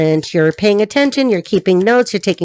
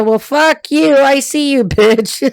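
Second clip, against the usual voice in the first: defiant taunt; 4 semitones higher, slower than usual. Retold or imitated. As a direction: throw it back at the stalker, half laughing. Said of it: "she's like".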